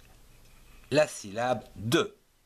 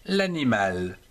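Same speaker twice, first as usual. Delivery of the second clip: ordinary talk, nothing marked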